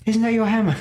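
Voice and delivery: high-pitched imitation of a film character; lightly playful, high put-on voice; Korg impression. Korg pops up with a joke about the hammer, high put-on voice. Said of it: "Korg comes up".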